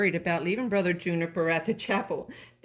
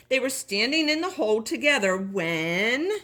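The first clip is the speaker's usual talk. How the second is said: usual speaking voice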